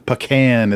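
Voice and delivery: pecan as puh-KAN; Texas pecan. Shown as a demonstration. Correcting a false start, pecan said puh-KAN. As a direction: say pecan with puh in place of pee. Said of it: "I'm emphasizing the P over the P".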